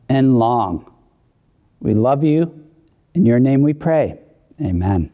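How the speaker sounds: prayer. Acted. Closing the children's message with a gentle prayer.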